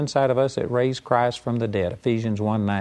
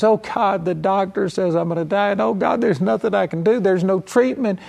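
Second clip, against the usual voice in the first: mock whiny plea, pitched higher; powerless pleading prayer; 6 semitones higher than usual. Preacher mimics powerless Christians begging God, whiny high plea. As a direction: imitate a helpless believer begging God. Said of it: "they go to God as".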